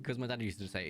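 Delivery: normal talking voice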